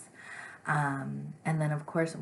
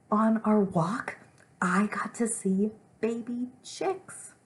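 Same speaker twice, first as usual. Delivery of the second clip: high, loud, drawn-out exclamation; demonstrating a big exclamation-mark delivery; very excited; 9 semitones higher, 8 dB louder, slower than usual. Shown as a demonstration. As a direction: gush it slowly and loudly, climbing in pitch. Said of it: "I'm very excited".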